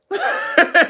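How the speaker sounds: real high laugh. Real, not acted. Laughing at the story.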